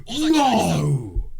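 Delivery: loud, high cry of denial; shocked, drawn-out outcry; anguished shout. Real, not acted. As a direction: shout it in horror, pitch falling from a peak. Victim's name revealed in game.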